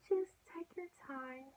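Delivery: high, soft, airy coaxing; sweet nurse. Retold or imitated. Triage lady calming her during vitals, sweet high hush. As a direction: imitate a tender, hushed reassurance. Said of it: "She's so sweet".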